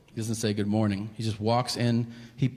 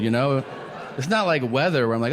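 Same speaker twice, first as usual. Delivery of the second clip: usual speaking voice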